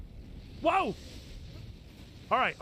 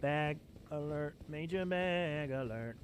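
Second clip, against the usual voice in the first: quiet alert; 9 semitones lower, 10 dB quieter than usual. Real, not acted. Spots a bag.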